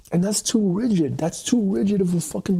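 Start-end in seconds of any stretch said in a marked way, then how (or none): none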